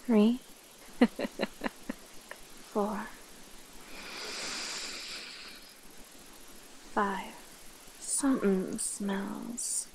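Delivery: slow, breathy count with long pauses; stalking predator. Acted. Counting while hunting the fleeing human, slow menace. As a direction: savour the scent, drawing words out.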